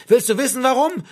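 high, loud, angry demand; shouted. A narrator's reading. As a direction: yell the question in his face. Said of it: "schrie Martin Klapp".

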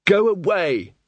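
muffled shout from behind a door; angry shout through a door; through a wall. Acted. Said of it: "the muffled voice behind the door".

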